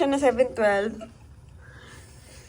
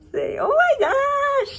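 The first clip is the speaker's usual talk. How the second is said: excited; 5 dB louder, slower than usual. Real, not acted. Birthday surprise from hotel staff.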